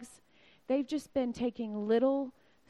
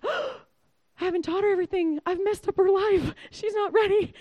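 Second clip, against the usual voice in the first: much higher and louder, breathless worry; panicky mom; 8 semitones higher, 6 dB louder than usual. Retold or imitated. A mother fretting over her senior daughter, hammed-up panic. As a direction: spiral into mock panic. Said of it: "I start to get panicky".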